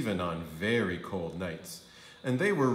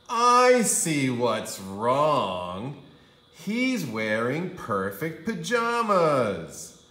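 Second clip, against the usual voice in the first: knowing mother; 5 semitones higher, 7 dB louder, much slower than usual. A narrator's reading. Mother diagnoses.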